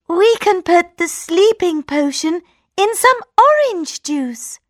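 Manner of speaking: high scheming princess; plotting princess. A narrator's reading. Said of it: "said the princess".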